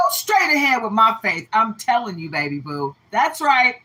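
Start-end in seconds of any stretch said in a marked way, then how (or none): none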